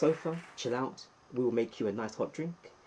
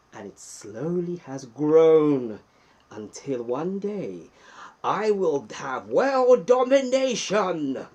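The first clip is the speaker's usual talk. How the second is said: slow, louder hammed-up villain boast; mock villain; 2 semitones higher, 8 dB louder, slower than usual. Streamer joking about his channel's growth, mock villain gloat. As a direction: gloat like a cartoon supervillain.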